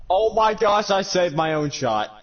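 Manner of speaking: high, loud disbelief; shocked exclaim. Real, not acted. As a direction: cry out in dismay. Own goal disbelief.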